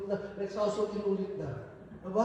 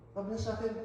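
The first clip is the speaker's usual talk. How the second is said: normal talking voice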